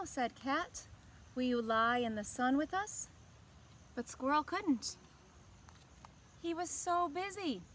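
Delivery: gentle, expressive storybook reading; picture-book narration to young listeners; reading to children. A narrator's reading. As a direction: read it like story time for kids. Story narration and character line.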